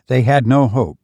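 usual speaking voice

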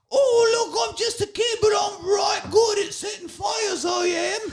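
put-on high, shouted cockney kid; kid's British accent. Voicing Deadpool's fire kid.

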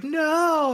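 high, drawn-out mock "no"; long high cry of a bad actress; mock falling cry. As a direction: wail it as if falling away. Retells actress leaping to her death.

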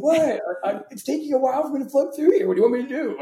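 mock echo from underground; echoing from a pipe. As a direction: shout back from a drainage pipe, oblivious. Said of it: "it's like, echoing all weird".

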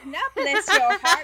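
loud, high Southern correction through laughter; big Southern protest, half laughing; hammed-up Southern accent.

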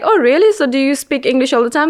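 high-pitched mock-surprised questioner; incredulous question, lightly mimicked; imitating surprise. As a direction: act out someone taken aback, pitched well up. Retells others' surprised question about English.

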